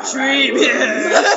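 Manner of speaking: laughing punchline; giggling joke. Real, not acted.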